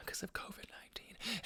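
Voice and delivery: whispered aside. Real, not acted. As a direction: whisper it, half secret. Aside about hotel room.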